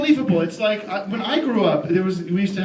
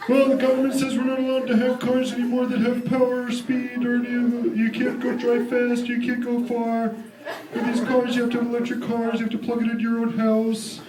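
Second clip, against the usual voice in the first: high, nasal-free mocking salesman voice; mock the dealer's smug explanation; car salesman; 5 semitones higher than usual. Car dealer explains the electric car rules, mocking high voice. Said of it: "And he goes".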